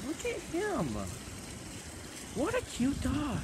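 high sing-song pet voice; cooing at dog. Real, not acted.

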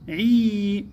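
عي stretched into a long, high "ee"; long vowel. Shown as a demonstration. Teacher sounding out the syllable, long drawn vowel. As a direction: draw out the vowel of عي slowly. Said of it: "مد مع ممدود".

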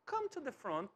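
ordinary talk

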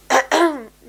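loud rough throat clear; real throat clear from a cold. Real, not acted. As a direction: clear the sore throat hard. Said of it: "Meine Stimme verabschiedet sich".

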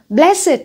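Blessed said as BLESS-id; high, punched first syllable in "Blessed", then -id; -ed as separate syllable. Shown as a demonstration. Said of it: "mình sẽ bật ra là ật".

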